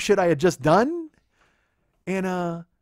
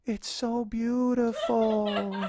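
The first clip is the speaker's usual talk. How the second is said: slow, raised, drawn-out voice; girlfriend's teary awe; 4 semitones higher, much slower than usual. Retold or imitated. Girlfriend finally seeing the surprise view, melting in awe. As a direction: stretch it out high and slow.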